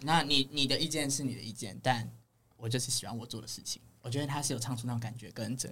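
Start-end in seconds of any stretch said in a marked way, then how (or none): none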